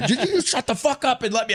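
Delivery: high, fast laughing imitation of the angry coach; mock coach yelling.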